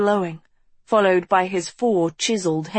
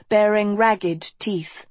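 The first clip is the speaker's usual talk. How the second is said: ordinary delivery, nothing marked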